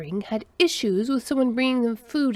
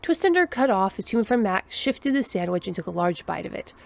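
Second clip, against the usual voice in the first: plain reading voice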